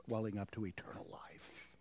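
scripture fading into a whisper. Acted. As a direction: let eternal life drift off in a whisper. Verse ends in whisper.

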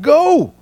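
"go" leaps high and loud; emphasis wrongly on go; misplaced stress on go. Shown as a demonstration. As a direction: punch "go" as if it were the command. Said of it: "our English word order makes us think".

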